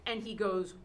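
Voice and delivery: ordinary talk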